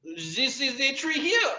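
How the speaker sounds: very high, mock French accent; hammed-up French child's squeak; high-pitched French-accented English. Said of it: "like we are all from France".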